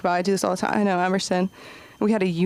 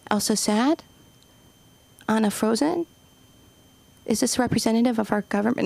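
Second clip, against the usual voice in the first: put-on toddler voice. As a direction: play the two-year-old niece thinking hard. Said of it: "she's like".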